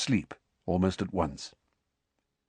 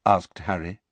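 ordinary delivery

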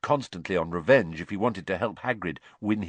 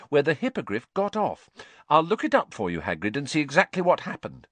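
Hermione; 8 semitones higher than usual. A narrator's reading. Hermione voiced in story.

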